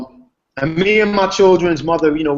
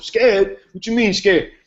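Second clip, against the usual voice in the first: defensive, indignant, pitched higher; chest puffed up; 6 semitones higher, slower than usual. Retold or imitated. To the nephew, offended at being called scared, indignant. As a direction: bristle at the word scared. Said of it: "your chest puffs up".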